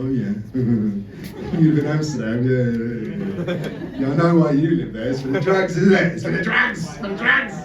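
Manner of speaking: mock knowing insinuation; assuming know-it-all. People back in England teasing an expat, mock knowing voice. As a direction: imitate someone sure they know your secret. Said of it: "People are like".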